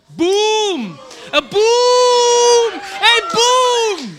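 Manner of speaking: high, loud shouted boom; triumphant shout. Real, not acted. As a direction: hit it like a mic drop.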